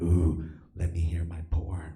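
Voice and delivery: low, naughty whisper; sneaky whisper. Acted. Kid creeping to the computer at midnight, sneaky whisper. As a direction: whisper it secretively to yourself.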